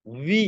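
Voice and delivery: "ভি" drawn out, air between the lips, rising; correct V sound. Shown as a demonstration. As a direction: model "ভি" alone with a rising glide. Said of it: "দুই ঠোঁটের মাঝখান থেকে বাতাস".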